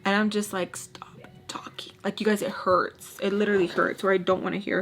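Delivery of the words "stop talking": slow, voiceless plea under the breath; exasperated whisper. Acted. Vlogger's unspoken answer to the woman, exasperated whisper. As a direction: whisper it as if worn out by chatter. Inner reaction to her chatter.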